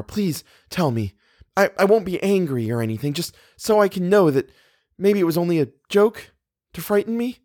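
high, pleading voice; appealing. A narrator's reading. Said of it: "She looked appealingly at the doctor".

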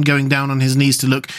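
plain reading voice

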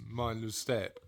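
a hint of deadpan discovery; mock solemn reading. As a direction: read the sign like a grand mystery solved. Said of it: "I wonder what that really exotic sign".

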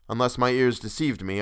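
ordinary delivery, nothing marked

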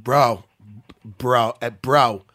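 imitated trainer's loud, punchy one-word answers; Mark Henry. Answering a question about a fighter, imitated emphatic exclamations. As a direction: punch each one out loud, pausing between. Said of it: "twenty bros".

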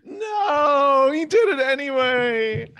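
loud, high, drawn-out sitcom whine; Seinfeld. Real, not acted. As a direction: stretch it out high and loud in a comedian's cadence. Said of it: "I, I kind of sounded like Seinfeld".

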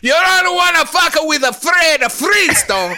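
high shouted fake Italian; Italian accent. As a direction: growl it like a tough Italian. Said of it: "I don't know where that accent".

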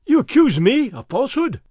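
high, loud offended outcry; the friar, accused of lying, crying out in outrage; indignant cry. A narrator's reading. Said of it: "Fray Felipe cried".

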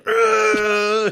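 a high, strained mock grunt of frustration; hammed-up frustrated ugh; mock angry grunt. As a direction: grunt like the man clenching his fist. Man makes a fist in movie.

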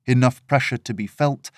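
ordinary delivery

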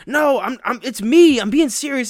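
high, loud mock insistence; mock pleading. Imitating a friend insisting in the dark, high and loud. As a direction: insist loudly it's really you.

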